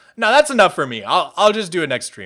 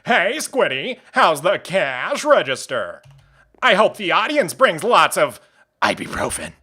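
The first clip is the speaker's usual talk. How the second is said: slow, swooping cartoon rival impression; Squilliam; slower than usual. Shown as a demonstration. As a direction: taunt with stretched, up-and-down vowels. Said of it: "I wish Squilliam had more lines".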